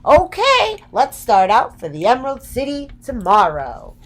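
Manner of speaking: rally the group; cheerful Dorothy. Acted.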